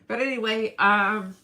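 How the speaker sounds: usual speaking voice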